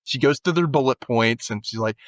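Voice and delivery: usual speaking voice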